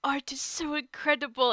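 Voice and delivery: high, loud retold gush; mildly overwhelmed with wonder; tearful awe. Staring at friends' prints on the wall, moved to tearful awe. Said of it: "I was legit crying".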